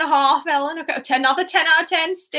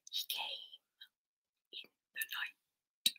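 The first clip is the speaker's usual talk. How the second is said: breathless ghostly whisper, much softer; eerie whisper; 20 dB quieter than usual. Shown as a demonstration. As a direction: hush it out like a secret in the dark. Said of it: "in the voice of the people".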